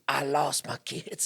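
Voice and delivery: slow, drawn-out put-on voice; homeless woman impression. Homeless woman telling stranger of lost kids, slow plea. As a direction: imitate a distressed woman, words stretched. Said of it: "she talked to me like this".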